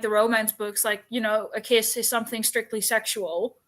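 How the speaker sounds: ordinary talk, nothing marked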